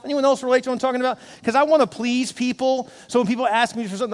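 usual speaking voice

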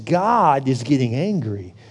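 normal talking voice

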